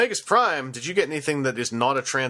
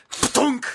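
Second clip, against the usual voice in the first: imitated gun thunk; 11 semitones higher than usual. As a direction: pop it out like a dart firing. Imitates toy gun firing.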